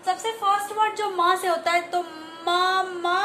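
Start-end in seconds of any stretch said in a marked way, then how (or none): 2.5-3.2 "मा, मा" with held m and stretched vowel; phonics sounding-out. Shown as a demonstration. Teacher sounding out M words, sustained "मा, मा". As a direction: draw out each "मा" evenly. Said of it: "इस तरीके से".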